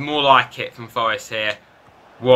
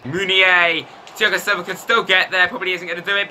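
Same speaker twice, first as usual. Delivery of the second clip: rising excited commentary, higher; play-by-play; 8 semitones higher than usual. Real, not acted. FIFA player calling his attack, rising excitement. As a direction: call the chance as it unfolds. Live goal chance commentary.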